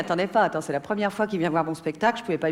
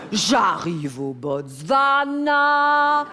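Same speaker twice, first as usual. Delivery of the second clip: drawn-out epic narration, words held long; grandiose adventure telling; much slower than usual. Acted. Comedian telling her Botswana jungle adventure, grandiose. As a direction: stretch each phrase into a grand jungle saga.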